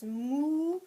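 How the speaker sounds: loud imitated moo; cow moo. Shown as a demonstration. Teacher showing children the cow's sound, loud moo. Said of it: "and the cow says".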